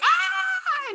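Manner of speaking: high-pitched mock scream of fright; a sudden squeal of fear. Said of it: "Then he's just like".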